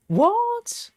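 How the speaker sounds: high, stunned "What?"; shocked what. Real, not acted. Hearing the grandfather's name was John, stunned disbelief.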